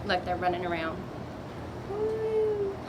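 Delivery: plain reading voice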